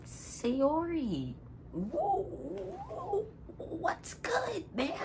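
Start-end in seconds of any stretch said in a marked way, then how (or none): 1.7-4.9 very high squeaky voice; squeaky panic. Real, not acted. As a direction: squeak out a nervous cover-up. Caught out, panicked cover-up.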